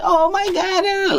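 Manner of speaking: very high, slow, giggly squeal; girlish laugh. Retold or imitated. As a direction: drag it out like a giggling girl. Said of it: "his bitch laughing like".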